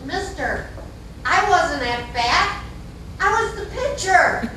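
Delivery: put-on high, proud kid's punchline; little boy. Little boy correcting the gentleman, proudly. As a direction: set the grown-up straight, slow and rising high.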